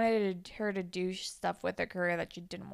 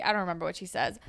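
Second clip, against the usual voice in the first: ordinary delivery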